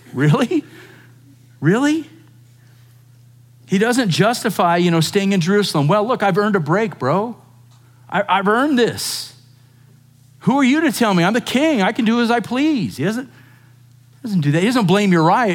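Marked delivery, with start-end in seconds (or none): 0.1-2.1 incredulous. Real, not acted. Pastor mocks excuse incredulously. 5.9-13.0 mock defiant king. Mock king's excuse, defiant.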